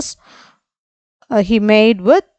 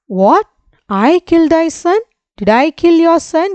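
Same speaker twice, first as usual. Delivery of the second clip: a scared merchant, voice pitched up high; shaky frightened exclaim; 7 semitones higher than usual. A narrator's reading. Merchant fearfully answering the angry genie, high and shaky. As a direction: gasp it out in sudden fear, pitched high. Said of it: "exclaimed in a shaky voice".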